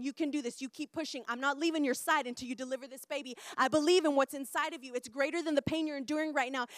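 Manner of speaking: urgent birth-coach encouragement; acted-out pep talk at a bedside; rooting them on. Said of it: "rooting them on".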